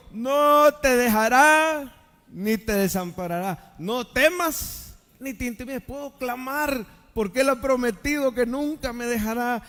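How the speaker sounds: proclamar con voz fuerte y más alta, alargando las frases clave; prédica enérgica. Acted. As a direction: proclama la promesa a la congregación con fuerza. Preaches promises at full force. (predicación encendida)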